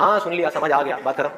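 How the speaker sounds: low-pitched, mildly offhand acknowledgement; dismissive listener. Acted. Listeners shrugging off a simple answer, mildly dismissive. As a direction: wave it away as already understood. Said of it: "आप कहते हैं".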